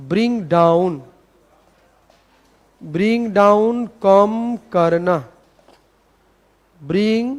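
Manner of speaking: slow, measured dictation with pauses. Shown as a demonstration. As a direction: read it out for students copying it down. (धीरे-धीरे इमला बोलने का अंदाज़)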